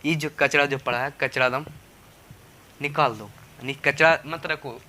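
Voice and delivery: ordinary delivery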